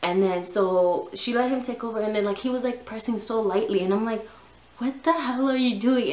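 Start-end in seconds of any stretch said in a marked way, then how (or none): 4.8-6.1 higher, exasperated snap at husband; angry outburst. Retold or imitated. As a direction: let your frustration burst out. Husband presses too lightly, angry.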